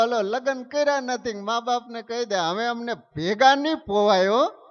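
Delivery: high, loud and defiant; defiant youngster. Acted. Young couple telling parents they won't live together, defiant. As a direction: throw it at the parents like a rebel teen. Youth defying parents, acted quote.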